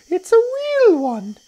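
very high, loud bird shriek; high-pitched urgent bird warning; Roly-Poly Bird. A narrator's reading. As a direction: screech an alarm, drawing out the words. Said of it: "sang the roly-poly bird".

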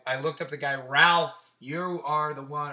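usual speaking voice

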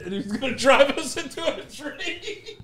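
high, laughing through the words; real laughing. Real, not acted. Son retelling dad's wild drive, laughing. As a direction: tell it while cracking up.

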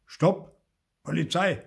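loud, high, punched-out command; police loudspeaker call. A narrator's reading. Chief policeman halting the bus, calling out commandingly. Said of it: "in den Lautsprecher sagen".

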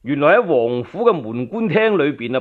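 ordinary delivery

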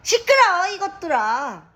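sharp high yell at the birds; shouting. Real, not acted. Yelling at noisy parrots.

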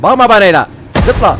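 high, very loud command; shouted order. Real, not acted. Player to the staring baby, shouted order.

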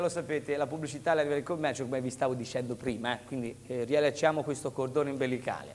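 normal talking voice

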